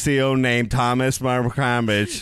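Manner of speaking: slow imitated simpleton drawl; Forrest Gump. Coworker retells customer's tale, slow mock drawl. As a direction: drag the words out in deadpan mimicry. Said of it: "guy who talked like Forrest Gump".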